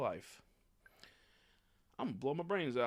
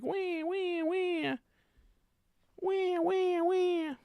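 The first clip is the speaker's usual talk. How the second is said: much higher whiny wee wee whimper; squeaky fake-cry noises; mock whimpering; 6 dB louder, much slower than usual. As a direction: whimper it like a crying baby. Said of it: "I'm gonna be like".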